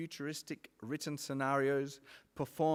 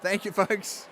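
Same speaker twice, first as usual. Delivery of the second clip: raised voice over the cheering; loud call over crowd; 7 semitones higher, 11 dB louder, slower than usual. Real, not acted. Host thanking a cheering hall, loud call. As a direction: thank the crowd loudly above the noise.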